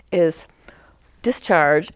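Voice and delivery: normal talking voice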